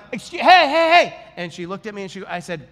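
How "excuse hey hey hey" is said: loud, high call across a restaurant. Retold or imitated. To the waitress who keeps walking by, a loud high call. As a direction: flag her down, hey hey hey.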